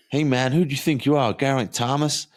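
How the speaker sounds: a jokey American driver calling out; teasing a cyclist from a car window in a hammed-up American accent; mock American accent. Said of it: "That was my American accent".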